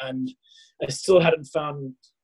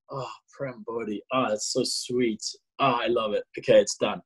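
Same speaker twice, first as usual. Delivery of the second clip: savouring; 4 semitones lower than usual. Retold or imitated. Man trying out his new name, savouring it.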